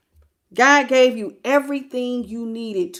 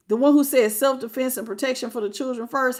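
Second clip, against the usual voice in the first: usual speaking voice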